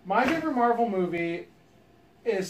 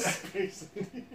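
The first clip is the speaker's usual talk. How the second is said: laughing; 8 dB quieter, much slower than usual. Real, not acted. Laughing repeat.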